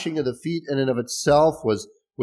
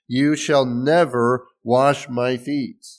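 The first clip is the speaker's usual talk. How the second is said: very slow, weighted YOU SHALL NEVER; drawn-out, deliberate "never wash my feet"; emphatic refusal; 2 semitones higher, 4 dB louder, much slower than usual. Acted. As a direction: spell out the refusal word by word, stressing NEVER. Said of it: "maybe say it's even more emphatic".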